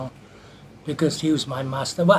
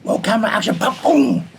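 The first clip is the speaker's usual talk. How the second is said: high, loud film-set call with punched sound effects; raised voice, popping out the hits; calling action; 7 semitones higher, 6 dB louder than usual. Retold or imitated. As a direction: re-enact the director's call, then voice the blows. Re-enacting film set call and hit.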